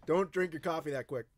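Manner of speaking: speaking between coughs after choking; still coughing. Real, not acted. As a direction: push the words out through a cough. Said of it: "Down the wrong pipe".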